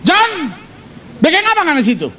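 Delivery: a wife catching her husband out; wife's voice. Acted. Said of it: "Dia bilang".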